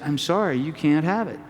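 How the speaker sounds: ordinary talk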